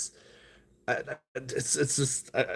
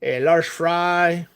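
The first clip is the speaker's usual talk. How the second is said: put-on raised, slowed Mexican accent while ordering; playful Mexican accent, higher and dragged out; 6 semitones higher, 4 dB louder than usual. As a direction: slip into a Mexican accent at the counter, drawing out the large fry. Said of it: "I start talking with an accent".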